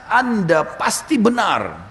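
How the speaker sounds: high, very slow proclamation; Abu Bakar. Retold or imitated. Abu Bakar affirms the Prophet before all, calling out. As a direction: proclaim each word, drawn right out. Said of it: "teriak dengan suara keras".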